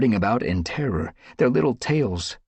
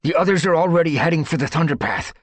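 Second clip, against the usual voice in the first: fast urgent; 8 semitones higher, 5 dB louder, faster than usual. A narrator's reading. Urgent escape speech.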